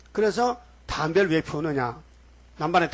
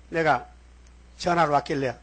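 ordinary talk, nothing marked

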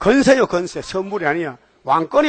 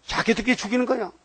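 usual speaking voice

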